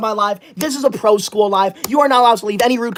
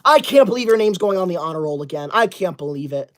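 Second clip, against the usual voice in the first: ordinary talk, nothing marked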